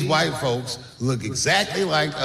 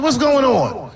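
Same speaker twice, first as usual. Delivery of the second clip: alarmed dad; 9 semitones higher, 6 dB louder, slower than usual. Retold or imitated.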